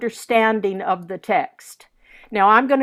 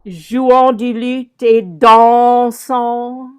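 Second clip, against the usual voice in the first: slow, loud French declamation, dansant stretched on its first syllable; drawn-out verse leaning hard into dansant; insistance; 3 semitones higher, 7 dB louder, much slower than usual. Shown as a demonstration. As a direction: swell and hold the "dan" of dansant. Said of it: "how often we have insistance".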